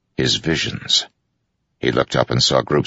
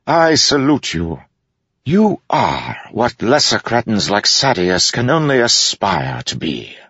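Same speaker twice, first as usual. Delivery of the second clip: mock solemn salute; 10 semitones higher than usual. A narrator's reading. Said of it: "his voice growing solemn".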